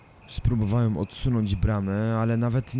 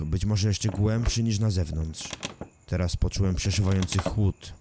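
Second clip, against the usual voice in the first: plain reading voice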